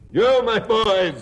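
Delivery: rich, jolly calling-out voice; jovial voice. Acted. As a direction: call out big and hearty. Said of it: "jovial voice".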